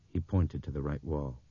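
ordinary delivery, nothing marked

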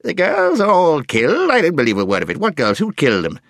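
loud, high outburst of disbelief; crotchety deaf dwarf. A narrator's reading. Deaf dwarf mishearing the owl, loud and indignant. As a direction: blare it out, starting high.

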